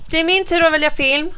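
lightly voiced eager boy. A narrator's reading. Bo claims his turn to choose the film, eager boy. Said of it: "säger Bo".

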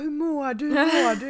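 high, urgent mock-concerned questioning; worried messages. Worried friends' messages, acted out. (spelat orolig, hög röst)

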